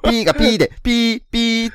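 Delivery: high squeaky mock censor beep; bleeped out. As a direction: chirp out pee like a bleep tone. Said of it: "ピー音を入れる".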